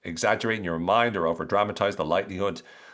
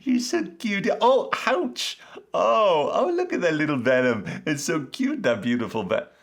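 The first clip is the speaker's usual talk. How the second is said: much higher baby-talk cooing, mock naive; cooing at a snake; 8 semitones higher than usual. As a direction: coo at the venomous snake like it's a puppy. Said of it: "we didn't go up to a snake going".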